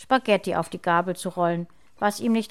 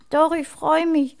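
high, loud tearful protest; acted childish whimpering, pitched up; sobbing little girl; 7 semitones higher, 6 dB louder than usual. A narrator's reading. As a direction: protest as an upset small child. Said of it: "Lena schluchzte auf".

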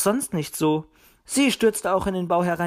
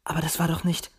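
plain reading voice

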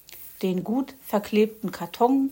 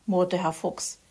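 plain reading voice

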